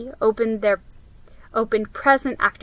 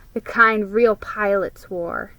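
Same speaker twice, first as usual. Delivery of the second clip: ordinary delivery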